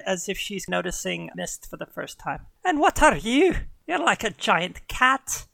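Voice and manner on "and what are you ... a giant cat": higher, curious Irish lilt; Irish huntress. Acted. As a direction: size up the cat in a high Irish brogue. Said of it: "slipping into Irish now".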